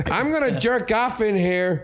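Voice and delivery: high, slow mimicked announcement; Andy Dick. Imitating Andy's last words at door.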